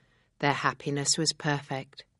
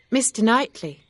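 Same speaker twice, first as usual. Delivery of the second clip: higher-pitched, louder heroine's voice; Emma, self-reproachful; 7 semitones higher, 7 dB louder than usual. Acted. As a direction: voice a story heroine admitting her mistake, pitched up.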